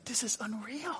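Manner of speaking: breathless hush of disbelief; awed whisper. Retold or imitated. Son whispering in awe while baptizing his father. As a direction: whisper it in wonder.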